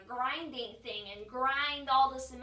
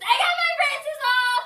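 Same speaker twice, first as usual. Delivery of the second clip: joyful high shout; excited shout; 14 semitones higher, 17 dB louder than usual. Real, not acted. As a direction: yell the good news. Announces braces off.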